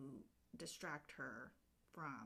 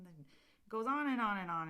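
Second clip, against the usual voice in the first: usual speaking voice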